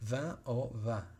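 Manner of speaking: "va o va" as French vin, nasal vowel, no N heard. Shown as a demonstration. Teacher shows students how French vin sounds, nasal vowel. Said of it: "entonces la pronunciación es".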